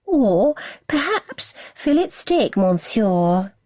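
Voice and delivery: slow, high child's fake French-posh accent; mock French accent. A narrator's reading. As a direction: ham up a French waiter accent. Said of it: "adding a French twist".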